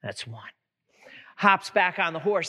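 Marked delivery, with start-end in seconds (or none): 0.0-0.5 low, soft, menacing count; quiet warning. Acted. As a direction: drop low and quiet as a restrained threat. Groom coldly counts the horse's offense.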